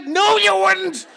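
very high, very loud explosive contradiction; screaming cop. Retold or imitated. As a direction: bellow it back in disbelief, imitating a furious cop. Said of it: "starts screaming all this psycho stuff".

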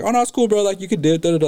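high-pitched, breezy mock-friendly voice; jolly boss. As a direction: imitate an overly jolly boss brushing it off. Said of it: "he was being all jolly".

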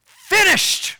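high, loud ringing cry of the word; Jesus' final loud cry from the cross. Retold or imitated. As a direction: cry it out with everything. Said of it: "Jesus cried with a loud voice".

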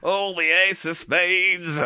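slow, high, hammed-up dismay; theatrical horror. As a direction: drag it out in mock horror.

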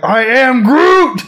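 slow, high mock Groot line; cheering Groot quote. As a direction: bellow it like a cheering fan. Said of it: "I was like".

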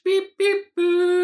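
very high, loud beep-boop noises; high electronic beeps. Pretend. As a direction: squeak it like a computer blip. Imitating opening title beeps.

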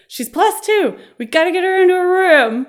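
high, loud mock nurse call; imitating an alarmed nurse; urgent alert. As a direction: call it out to the whole staff.

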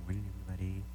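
faint, barely reaching the mic; a soft greeting lost off mic; too soft. Real, not acted. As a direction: say it far too softly. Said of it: "You've got such a soft voice".

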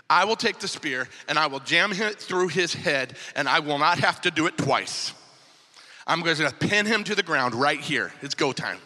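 low-pitched acted warrior's vow; low resolve. Abishai offers David to kill the enemy, low acted resolve. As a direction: drop the voice low for a grim offer.

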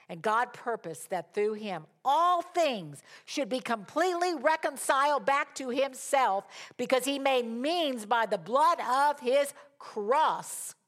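loud, high declamation with drawn-out key words; preaching scripture. Acted. Preacher reading a verse to the congregation, emphatic proclamation. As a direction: proclaim the verse with rising force. Reads scripture at full force.

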